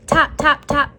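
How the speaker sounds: plain reading voice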